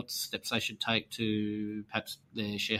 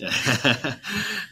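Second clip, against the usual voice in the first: hearty laugh; 10 semitones higher, 8 dB louder than usual. Real, not acted. Host laughs at joke.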